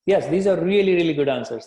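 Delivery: loud, eager praise for the class; enthusiastic praise. Real, not acted. Teacher praising student answers.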